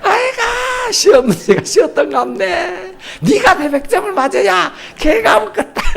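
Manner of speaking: very high, laughing sneer; laughing mock father. Preacher replays the father's put-down, high and laughing.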